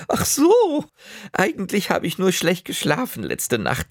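hohe Omastimme, etwas verlegen erklärend; erleichterte Oma. Acted. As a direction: gib kleinlaut zu, dass du schlecht geschlafen hast. Oma erklärt ihre schlechte Laune. (Oma, hoch und verlegen)

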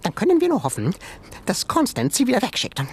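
high, quick little creature voice; high animal voice. Acted. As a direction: squeak it like a small museum animal. Animal voice in story.

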